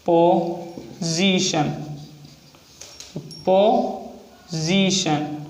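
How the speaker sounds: Position stretched out very slowly; position said slow and drawn out; slow dictation. Shown as a demonstration. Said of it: "next example है position".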